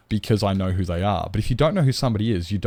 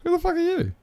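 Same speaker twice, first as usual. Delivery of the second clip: far higher, squealing indignant repeat; shrieking mock outrage; faster than usual. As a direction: crank it into an over-the-top squeal. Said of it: "it'd be like".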